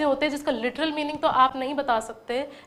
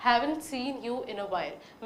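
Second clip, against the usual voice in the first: very slow and softer; slow, softer demo; 5 dB quieter, much slower than usual. Shown as a demonstration. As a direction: say it slowly and gently. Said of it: "वो sentence क्या है कि". (धीमे और नरम आवाज़ में)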